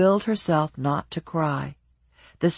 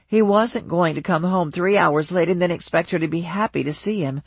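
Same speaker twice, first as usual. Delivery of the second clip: ordinary delivery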